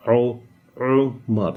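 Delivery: slow Umar from a tight throat; throat ain Umar. Shown as a demonstration. Teacher repeating Umar slowly for the class. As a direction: stretch out the throat sound in Umar. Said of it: "It's coming out from here".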